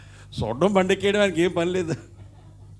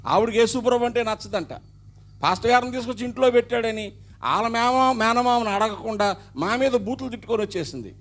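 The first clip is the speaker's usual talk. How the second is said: raised, urgent preacher voice; impassioned preaching; 7 semitones higher, 3 dB louder than usual. Acted. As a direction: preach it out high and loud to the crowd.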